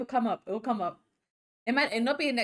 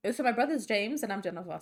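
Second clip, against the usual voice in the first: normal talking voice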